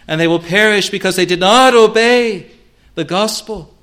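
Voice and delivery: loud, high-pitched sermon delivery; forceful preaching. Real, not acted. Preacher pressing sinners to come to Christ, forceful. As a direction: preach it loud, driving home each phrase.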